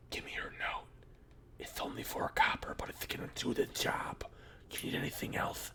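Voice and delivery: soft, secretive whisper; conspiratorial whisper. Acted. The kid whispering the secret deal to the prisoner.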